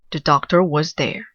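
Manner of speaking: plain reading voice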